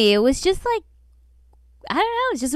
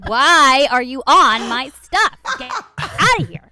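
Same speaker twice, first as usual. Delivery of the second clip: mock angry retort; 6 dB louder than usual. Poster telling off a meddling commenter, mock angry and loud.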